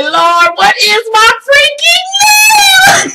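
high, loud, drawn-out tearful cry; panicked wail. Retold or imitated. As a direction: wail it slowly in mock desperation. Acts out panic at forgetting name.

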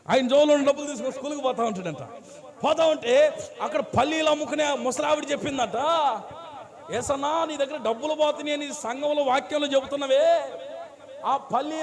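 high, loud preaching of the tale; a preacher's story at full force; loud sermon storytelling. Acted. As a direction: drive the story home from the pulpit.

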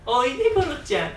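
high, sweet call to a cat; high coaxing cat call. Retold or imitated. Eri calling her cat Goro over, high and sweet.

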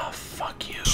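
very soft, whispered swearing; whispered curse. Real, not acted. Player cursing the game, whispering. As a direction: whisper it under the breath.

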